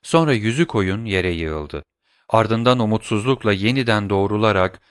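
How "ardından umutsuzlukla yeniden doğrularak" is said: plain reading voice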